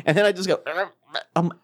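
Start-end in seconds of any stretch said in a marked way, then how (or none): 1.4-1.5 laughing through the words. Real, not acted. Laughing while telling puke story.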